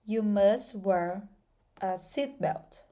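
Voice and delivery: slow and deliberate, word by word; careful slow English example; slow model sentence. Shown as a demonstration. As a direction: spell the sentence out slowly. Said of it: "Então".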